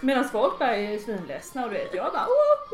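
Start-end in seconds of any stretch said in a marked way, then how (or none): 2.3-2.7 high, loud joyful whoop; party whoop. Retold or imitated. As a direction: cheer like you're the life of the party. Cheering at a party. (glad partyskrik)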